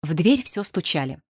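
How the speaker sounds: plain reading voice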